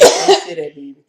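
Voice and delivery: loud high pleading cry of denial; desperate cry. Retold or imitated. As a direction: shout it, begging it not to be true. Denying son says mother's gone.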